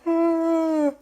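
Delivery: loud, high yawn; unexpected noisy yawn; sudden yawn. Real, not acted. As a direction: let a big yawn burst out. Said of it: "that yawn came out of nowhere".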